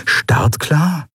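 high, questioning surprise; puzzled wondering. A narrator's reading. Nelen overhearing the captain's orders, puzzled. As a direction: wonder at it with rising pitch. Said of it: "wunderte sich Nelen".

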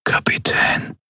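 faint, breathy whisper; barely a breath of air; thin as a breath. A narrator's reading. The waking Miko whispers to his captain, faint. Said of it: "Die Stimme klang dünn wie ein Lufthauch".

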